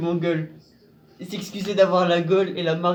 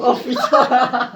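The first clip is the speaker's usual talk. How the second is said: drawn-out punchline; 6 semitones higher, 8 dB louder, much slower than usual. Acted. Dramatic punchline reading a card.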